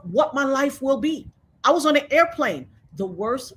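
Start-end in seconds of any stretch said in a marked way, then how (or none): none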